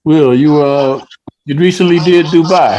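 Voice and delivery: ordinary talk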